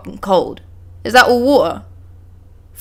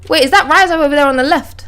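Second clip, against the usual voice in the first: ordinary talk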